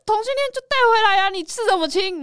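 loud, high-pitched exasperation; scolding father. Retold or imitated. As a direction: imitate a dad griping in a family row. Father scolding in retold quarrel.

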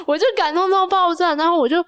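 high, breaking into laughter while telling it; laughing, overwhelmed. Real, not acted. Telling her friend how moved she was, laughing high. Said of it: "我真的情绪很激动欸".

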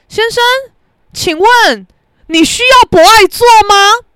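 loud, slow, pointed challenge; confrontational call-out. Retold or imitated. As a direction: fire the question out high and drawn-out. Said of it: "我就把博爱座这三个字他妈的呛出来". (大声拉长、呛声质问)

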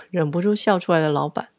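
ordinary delivery, nothing marked

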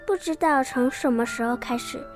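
ordinary delivery

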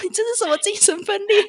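high, fast stunned retort; husband dumbfounded by the mood swing; baffled husband. Retold or imitated. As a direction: fire back in disbelief. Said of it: "老黄就说".

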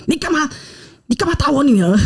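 imitating the girl's mother objecting, lightly; angry mother. Mother of the girl demands why they hit her daughter, mild mock anger. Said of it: "女的声音说".

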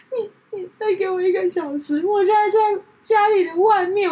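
high, slow, tearful pleading voice, breaking into sobs; crying while pleading. Retold or imitated. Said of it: "她就哭着跟我说".

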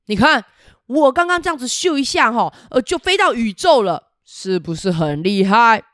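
loud, bragging dragon voice; boastful dragon. Acted. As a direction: brag like a proud dragon to the kids. Dragon voiced in picture book. (得意洋洋的喷火龙)

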